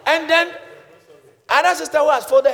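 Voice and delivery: high, loud excited telling; excited preaching. Real, not acted. Preacher retelling a joyful church moment, excited. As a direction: build the joy up.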